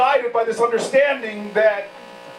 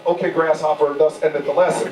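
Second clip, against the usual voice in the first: mock wise master; 7 semitones lower than usual. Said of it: "thinking to myself".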